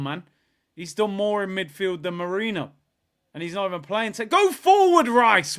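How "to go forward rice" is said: high, loud yell at the player; urging shout. Real, not acted. Fan yelling at Rice to go forward, urging shout. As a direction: shout forward at him in frustration.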